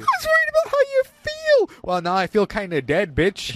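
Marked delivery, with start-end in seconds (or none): 0.0-1.8 very high mock-whiny wife voice; mocking the movie wife fretting in a very high whine. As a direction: squeak it out like a clingy wife.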